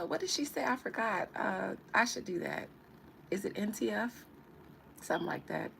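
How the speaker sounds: ordinary talk, nothing marked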